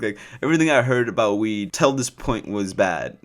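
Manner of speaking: ordinary delivery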